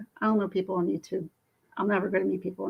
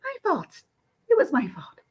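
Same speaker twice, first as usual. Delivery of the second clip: a high mocking jab then a breathy mutter; mock the idea it's her fault; sarcastic echo; 8 semitones higher than usual. Scoffing at the driver blaming her, sarcastic.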